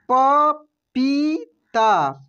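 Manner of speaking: पपीता drawn out very slowly, each syllable separate. Shown as a demonstration. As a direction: sound out पपीता slowly for learners. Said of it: "कैसे पढ़ेंगे हम लोग?". (पपीता को धीरे धीरे अक्षर अक्षर बोलिए)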